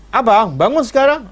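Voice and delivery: bossy wife order. Acted. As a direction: order him up like a nagging wife. Wife scolding husband to pray.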